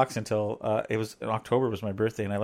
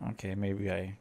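low, much softer confession; sheepish admission; 4 semitones lower, 14 dB quieter than usual. Real, not acted. Husband caught out by wife on price, sheepish. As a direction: back down quietly and low.

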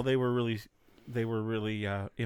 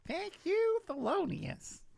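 high squeaky thank-you; high comic character voice; put-on voice; slower than usual. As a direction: squeak it like a cartoon critter. Quoting a movie character.

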